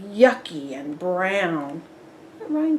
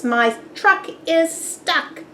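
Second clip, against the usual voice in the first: snapping; 7 semitones higher, 4 dB louder than usual. A narrator's reading. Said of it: "he snaps".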